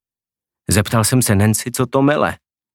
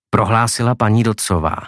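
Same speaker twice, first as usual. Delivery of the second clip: usual speaking voice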